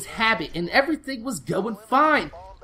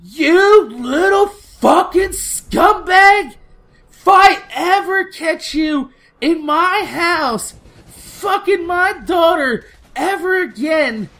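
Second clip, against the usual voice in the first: loud, slow, furious shrieking tirade, much higher; high-pitched mock mom screaming threats; screaming angry mom; 7 semitones higher, 7 dB louder, slower than usual. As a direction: scream it like an enraged mother catching him out. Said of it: "her mom gets out the car and she's like".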